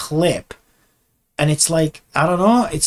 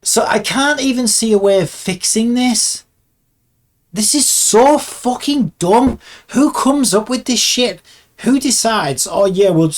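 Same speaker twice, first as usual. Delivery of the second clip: high, loud, incredulous outrage; real mad rant; 7 semitones higher, 5 dB louder than usual. Real, not acted. Animator fuming at software makers over a linked clip, mad. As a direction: rail at whoever designed this. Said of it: "I'm so fucking mad right now".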